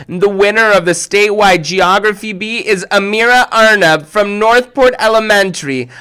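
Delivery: high, loud announcer call; winner announcement. Acted. Judge announcing Amira wins, loud and proud. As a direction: proclaim it like an emcee.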